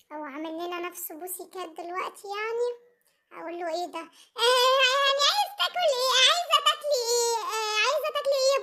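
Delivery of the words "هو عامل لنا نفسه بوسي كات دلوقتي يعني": normal talking voice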